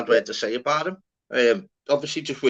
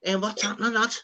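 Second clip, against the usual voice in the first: Scouse lad impression; 9 semitones higher than usual. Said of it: "But it'll be like".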